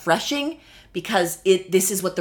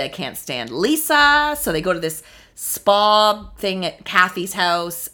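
normal talking voice

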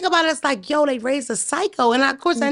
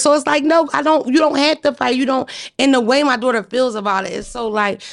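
normal talking voice

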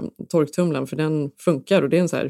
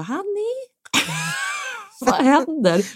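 high, sing-song call with a laugh; much slower than usual. Retold or imitated. Calling husband in the basement, high nervous laughing call.

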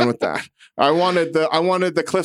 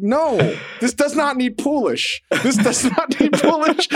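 mock protest; 9 semitones higher than usual. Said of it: "I was like".

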